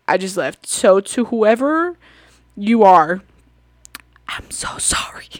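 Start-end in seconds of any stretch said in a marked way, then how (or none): 4.3-5.4 a soft sheepish whisper of sorry; whispered apology. Real, not acted. Vlogger whispering an apology to the man she spilled on. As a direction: whisper it, embarrassed and sincere. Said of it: "whispered apology to the stranger".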